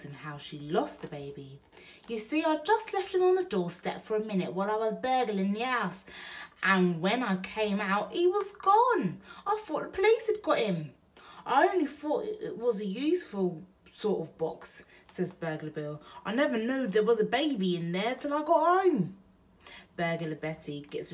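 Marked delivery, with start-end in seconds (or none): 2.1-14.8 put-on Betty tells Bill how she lost the baby; Burglar Betty. 16.2-19.2 Burglar Bill. Acted. Bill's voice.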